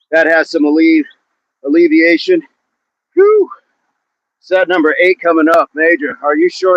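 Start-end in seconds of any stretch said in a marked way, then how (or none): 3.1-3.5 high-pitched puff of effort; high whew. Real, not acted. Trainer catching breath before set eight, high whew.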